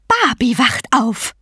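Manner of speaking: gloating vulture goddess. Acted. Vulture goddess announcing the baboon god waking, gloating. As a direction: announce it with slow, gleeful relish.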